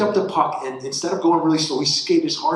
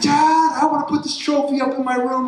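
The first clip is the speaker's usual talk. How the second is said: put-on much higher, louder eager child voice; excited kid; 13 semitones higher, 5 dB louder than usual. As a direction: play the thrilled son showing off his trophy. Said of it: "He's like".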